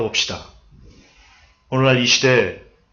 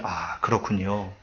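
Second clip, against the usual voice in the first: sincere soft reply; 6 semitones lower, 10 dB quieter, slower than usual. Shown as a demonstration. Showing a sincere listener reply.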